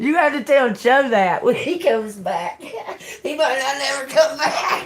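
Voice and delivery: talking through laughter; real cracking up while speaking; can't help laughing. Real, not acted. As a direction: let laughs spill between the words. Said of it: "I can't even help it".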